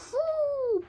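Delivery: high, long whoo; high dog howl. Acted. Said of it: "barked the dog".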